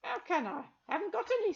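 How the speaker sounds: put-on high, cackly old crone; proud old shopkeeper witch. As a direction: pitch it up as a sly old woman.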